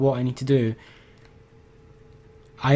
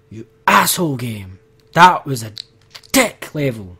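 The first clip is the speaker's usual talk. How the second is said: angry; 2 semitones lower than usual. Real, not acted. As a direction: spit it at the game. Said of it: "I'm too angry".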